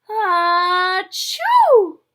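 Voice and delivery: loud, high sung-out achoo; mock sneeze. Showing the kids the sneeze in the picture, loud mock sneeze. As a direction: belt out a pretend sneeze. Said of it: "sneeze over here".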